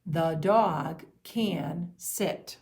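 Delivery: THE DOG CAN SIT, slow and drawn out; word-by-word dictation. Shown as a demonstration. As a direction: dictate each word slowly for young writers. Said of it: "Can you say that?".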